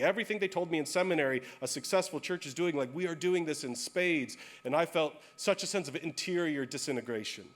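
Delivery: ordinary delivery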